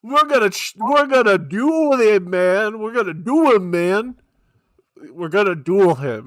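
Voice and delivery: loud, punchy cartoon hero line; loud cartoon quote. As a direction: belt it like an anime duelist. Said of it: "that's an actual line from the show".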